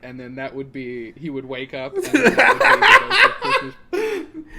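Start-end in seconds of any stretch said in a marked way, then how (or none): 1.9-4.6 high, loud laugh through words. Real, not acted. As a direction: burst out laughing mid-sentence. Cracking up at own joke.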